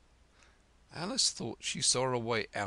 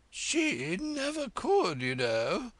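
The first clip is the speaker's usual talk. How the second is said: high, loud, slow haughty queen; imperious Red Queen; 14 semitones higher, 6 dB louder, slower than usual. Acted. Red Queen testing Alice on French, high and haughty. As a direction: quiz her grandly, drawing out fiddle-de-dee.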